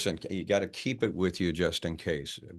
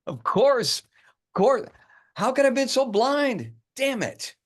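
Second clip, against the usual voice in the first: much higher and louder, elated realization; epiphany; 7 dB louder, slower than usual. Acted. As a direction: it all clicks at once, overjoyed. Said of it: "He begins to laugh to himself".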